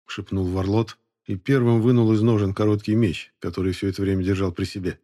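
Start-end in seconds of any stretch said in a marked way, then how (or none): none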